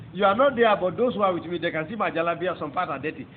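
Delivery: normal talking voice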